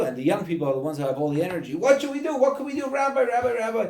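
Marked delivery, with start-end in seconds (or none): 1.7-3.8 mock clamouring. Energetic young people calling on their rabbi, mock clamouring. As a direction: imitate eager youngsters, very high and loud.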